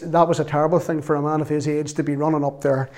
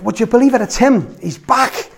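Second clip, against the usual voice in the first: raised, higher-pitched mock shout to a wife; excited retold shout; 6 semitones higher, 4 dB louder than usual. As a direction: call out the big news, higher and louder. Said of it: "he shouts to the wife".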